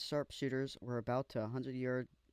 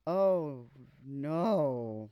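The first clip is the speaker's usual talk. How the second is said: loud, drawn-out horrified no; loud dread; 7 dB louder than usual. Real, not acted. Reading how the musician died, loud horrified groan. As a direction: groan it as you see what's coming. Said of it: "dread reading the death".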